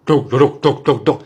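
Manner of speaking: heavy "dok" knocks in rhythm; imitated noodle-cart knocking. Noodle seller announcing himself, heavy knocking rhythm. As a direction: thump out a weighty wooden knock by mouth. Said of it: "Kalau lebih berat".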